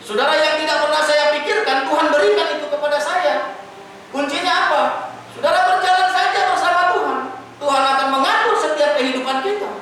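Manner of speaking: very high, loud, almost sung exhortation; preaching at full force. Acted. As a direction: call the church to walk with God. (seruan khotbah yang tinggi, keras, hampir dinyanyikan)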